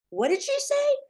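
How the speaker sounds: high, loud astonishment; stunned. A narrator's reading. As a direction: ask it high and loud in shock. Harrison stunned.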